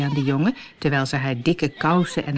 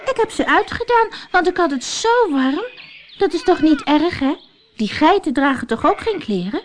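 put-on high, innocent small child explaining; cheerful young girl, much higher; little girl voice; 10 semitones higher than usual. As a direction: sweetly justify herself to her aunt. Heidi voiced by narrator.

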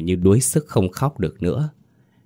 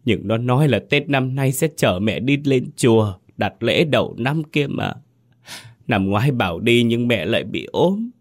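wailing; 6 semitones higher than usual. Acted. Mother grieving dead son.

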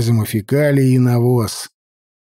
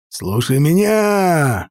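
slow, stretched battle roar; roaring command; 2 semitones higher, much slower than usual. Acted. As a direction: bellow it over the din. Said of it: "заревел Лидул".

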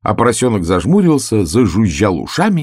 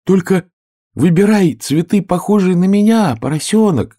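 high, louder flower calling out; shouted; 12 semitones higher, 5 dB louder than usual. A narrator's reading. Flower calling after the flying piglet, loud and high. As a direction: call up after him from the ground. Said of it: "крикнул цветок".

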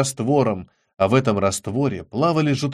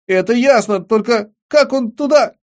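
high, louder puzzled demand; agitated bafflement; 10 semitones higher, 6 dB louder than usual. A narrator's reading. Boy asks friends about the baby in the jar, loudly baffled. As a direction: push the question up, baffled and insistent.

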